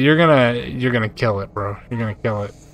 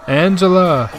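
raised, higher call for someone; 6 semitones higher, 4 dB louder than usual. Pretend. Player calls out for missing Angela in the game, calling out. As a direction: call out the name, louder and higher.

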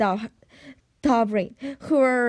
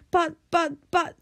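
flustered stammer; 5 semitones higher than usual. A narrator's reading. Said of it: "flustered Stoic the Best".

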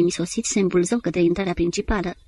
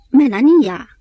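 high, loud cry of the name; exclaimed name, raised and falling at the end; exclaiming; 5 semitones higher, 9 dB louder, slower than usual. A narrator's reading. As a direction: call her name out sharply. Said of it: "exclama Antim".

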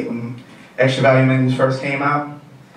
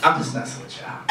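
whispering; 4 semitones lower, 7 dB quieter than usual. Real, not acted. Quiet aside after bit.

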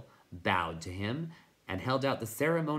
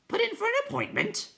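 very high, louder, quick hooting question; incredulous night hob; 5 dB louder, faster than usual. Acted. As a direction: squawk back the question in disbelief. Night hob echoes in disbelief.

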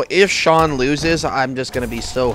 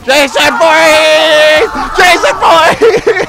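drawn-out hype shout; 13 semitones higher, 10 dB louder, slower than usual. Acted. Hyping up the move.

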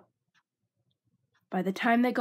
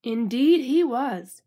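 ominous narration; 2 semitones higher, 6 dB louder, slower than usual. A narrator's reading.

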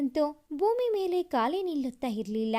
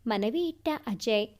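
usual speaking voice

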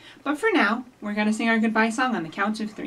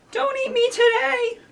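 high pleading little voice; squeaky scared dinosaur; 4 dB louder than usual. Acted. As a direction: beg not to be eaten.

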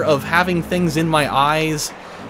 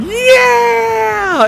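loud, very high, drawn-out shout; squealing game-show celebration; imitated PA cheer; 7 dB louder than usual. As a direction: whoop it like a warped kid announcer. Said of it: "this voice came over".

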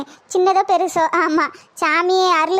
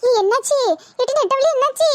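high, startled cry of concern; alarmed; 7 semitones higher than usual. Acted. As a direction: call out in sudden alarm. Alarm as woman gets possessed. (பதறிப்போய் கேட்பது)

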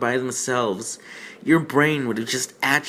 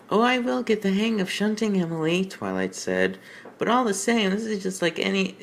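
Twilight's voice; 8 semitones higher than usual. Acted. Reading Twilight's lines in voice.

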